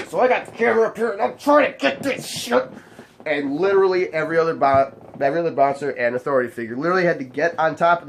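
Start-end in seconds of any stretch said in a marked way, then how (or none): 0.1-2.7 high-pitched, strained play-acting; pitched way up, frantic re-enactment; acted scramble. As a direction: say it as if filming mid-scuffle. Acts out filming during the scuffle.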